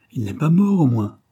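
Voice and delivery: loud, high-pitched alarmed question; crying out. A narrator's reading. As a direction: cry it out in sudden dread. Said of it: "cria-t-elle".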